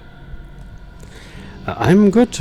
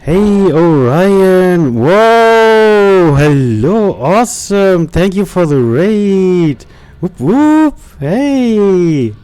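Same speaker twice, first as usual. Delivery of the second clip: higher, louder whooping hellos; excited greeting; 7 semitones higher, 5 dB louder, much slower than usual. Real, not acted. Streamer loudly welcoming the incoming raid, excited. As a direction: cheer the raiders in.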